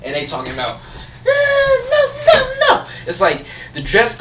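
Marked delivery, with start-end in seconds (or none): 1.3-2.9 very high, loud whiny squealed protest; high mock squeal. Student mocking complainers about the dress code, high squeal. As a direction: squeal it out in a loud cartoon falsetto. Acting out what they talk about.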